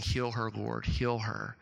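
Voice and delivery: low, softly imitated plea to God; quiet prayer. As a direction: pray it softly, as a retold child's prayer. Voices small daughter's simple prayer.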